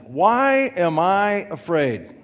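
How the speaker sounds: loud, slow call for all to repeat; call to congregation. Shown as a demonstration. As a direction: lead the room, drawing each word out. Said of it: "Now personalize it".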